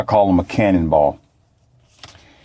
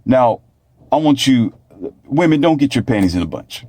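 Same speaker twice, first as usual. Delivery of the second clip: normal talking voice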